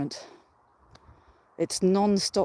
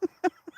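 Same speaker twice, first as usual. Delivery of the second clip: laugh; 11 semitones higher than usual. Real, not acted. Laughing at her own unfitness.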